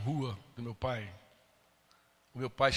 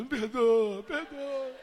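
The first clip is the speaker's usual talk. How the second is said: higher, pleading "perdoa" in a breaking voice; tearful plea; 9 semitones higher, 5 dB louder, much slower than usual. Retold or imitated. Retold father begging both women to forgive him, tearful. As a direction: beg for forgiveness through tears.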